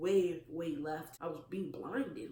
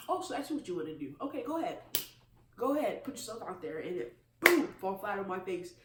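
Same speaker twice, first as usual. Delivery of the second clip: mock go-ahead; 3 semitones higher, 6 dB louder than usual. Acting out God daring her onward.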